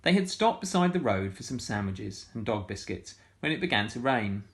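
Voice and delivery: usual speaking voice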